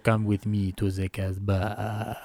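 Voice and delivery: put-on low, soft, slow seductive French accent. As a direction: purr it low like a French lover. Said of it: "He doesn't have [name] where he's like".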